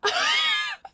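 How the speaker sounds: high squealing wheezy laugh; very high shrieking wheeze of a laugh; imitated wheeze laugh. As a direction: do his wheezing laugh into the mic. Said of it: "Sheppard in the mic go".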